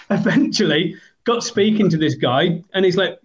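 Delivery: normal talking voice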